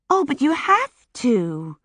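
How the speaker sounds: drawn-out disappointment; disappointed. A narrator's reading. Young Sunny to new acquaintance Mary, disappointed and slow. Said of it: "sounding so disappointed".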